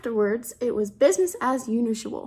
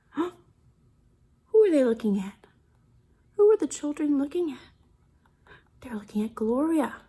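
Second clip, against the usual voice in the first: suspenseful whisper; 2 semitones higher than usual. Acted. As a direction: hush the room like you're sharing a secret. Reader builds suspense for kids.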